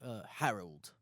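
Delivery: put-on low, soft British gent accent; put-on British accent, searching for words; British dude. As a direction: play a British bloke, low and hesitant. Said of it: "like, a British dude".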